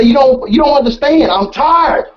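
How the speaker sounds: retold protest, pitched high; talking all loud. Husband pleading tiredness to wife, trying to sound loud. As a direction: raise the voice in mock complaint. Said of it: "I'm trying to talk all loud".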